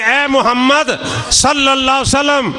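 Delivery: an uncle proclaiming loudly before his chiefs; loud declaration. Retold or imitated. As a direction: call it out slowly for the chiefs to hear. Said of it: "اونچی آواز میں".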